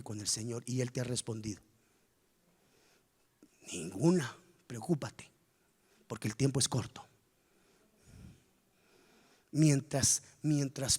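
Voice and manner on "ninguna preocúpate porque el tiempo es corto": warning whisper; hushed, breathy warning. Real, not acted.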